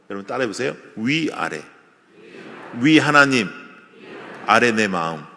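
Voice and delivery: usual speaking voice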